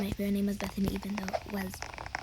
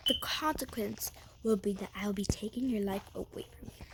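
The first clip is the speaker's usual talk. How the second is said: a hushed, menacing warning; menacing whisper; 5 dB quieter than usual. Retold or imitated. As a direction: breathe the threat like a villain. Threatening the liar in retold quarrel.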